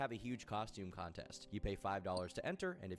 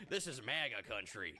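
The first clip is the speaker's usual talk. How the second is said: put-on southern accent. A woman quoting the men's chant in a light Southern accent. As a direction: drop into a mock Southern accent. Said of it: "in a southern voice".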